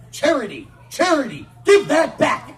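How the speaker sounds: loud parental yell across the fair; mock exasperated parent shout; yelling at child. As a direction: call the kid out sharply. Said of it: "yelling things like".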